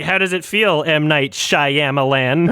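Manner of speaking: slow, gloating taunt; mock taunt. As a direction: savour the name like revenge. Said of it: "He's like".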